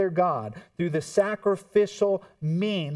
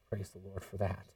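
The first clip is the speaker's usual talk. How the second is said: much softer and lower, almost to himself; hushed praise; 9 semitones lower, 12 dB quieter than usual. Real, not acted. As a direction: breathe it out in quiet awe. Awed aside, then repeated aloud.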